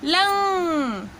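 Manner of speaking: लंग stretched very slowly, nasal ending held; drawn-out syllable. Shown as a demonstration. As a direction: sound out लंग slowly for the class. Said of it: "अंग की मात्रा मिलाएंगे".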